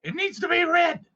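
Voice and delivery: high kobold squeak; squeaky kobold. Acted. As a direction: pipe up like a tiny excited kobold. Player voicing kobold demand.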